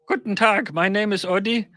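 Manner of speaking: first German phrase learned, mock German accent. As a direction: recite schoolbook German in a hammed-up German voice. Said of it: "the first bit of German I learned was".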